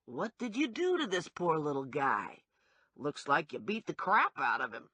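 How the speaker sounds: lightly voiced cop holding back a laugh; a hint of amusement under the words; suppressed laughter. A narrator's reading. Cop looking at the beaten little guy, barely holding back laughter. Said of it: "barely controlling laughter".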